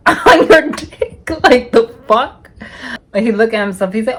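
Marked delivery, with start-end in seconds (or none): 0.1-2.5 slow, laughing, loud recoil; telling her hookup what she saw, laughing loud in disgust. Retold or imitated.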